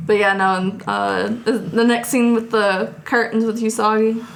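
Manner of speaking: ordinary talk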